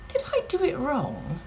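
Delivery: high-pitched mock helpless question; mock helpless woman. Woman asks bystander about flagging a bus, mock helpless. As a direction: play her cluelessness in a high voice.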